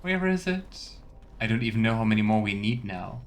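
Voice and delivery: usual speaking voice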